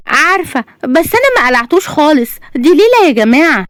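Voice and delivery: high, insistent excuse; defensive protest. A narrator's reading. Bride excuses removing her veil to friends, high and defensive.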